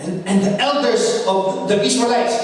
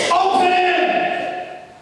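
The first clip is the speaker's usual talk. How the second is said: Moses commanding the sea; 10 semitones higher, much slower than usual. Acted.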